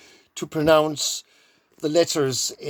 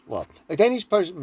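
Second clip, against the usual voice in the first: ordinary talk, nothing marked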